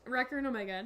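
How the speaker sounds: normal talking voice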